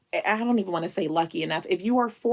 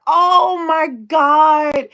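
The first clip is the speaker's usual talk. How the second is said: a loud, high, slow cry of joy; real joyful shout, high and drawn out; overjoyed cry; 9 semitones higher, 9 dB louder, much slower than usual. Real, not acted. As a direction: shout it out, overwhelmed with real delight. Overwhelmed by Kelly's super chat.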